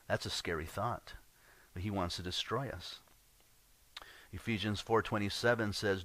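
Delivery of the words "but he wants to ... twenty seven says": normal talking voice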